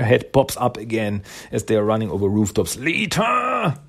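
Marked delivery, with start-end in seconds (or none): 2.8-3.8 high calling cry. Retold or imitated. Renette popping up to summon Lita, high calling cry. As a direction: call her name across the rooftops.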